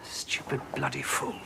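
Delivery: hissed under the breath; bitter whisper to himself; whispered curse. Acted. Muttered curse after bad news.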